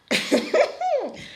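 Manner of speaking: giddy high-pitched giggle. Real, not acted. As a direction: squeal of a laugh at his name. Giggling over Shakib.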